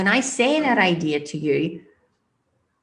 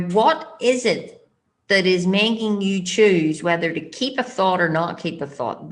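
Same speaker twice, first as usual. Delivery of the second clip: ordinary talk, nothing marked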